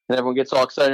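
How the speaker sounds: usual speaking voice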